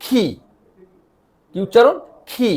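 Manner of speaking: খি said as a throaty khi; Danish letter G. Shown as a demonstration. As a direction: say খি with a rough throat sound. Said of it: "কিন্তু এই ক্ষেত্রে এসে খি".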